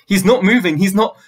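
frantic high, loud repeated cry; screamed. A narrator's reading. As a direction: mum screaming until she is cut off. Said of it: "she screamed".

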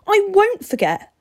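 high, loud defiant cry; screamed. A narrator's reading. Steve defying the vampire, screaming high. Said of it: "Steve screamed".

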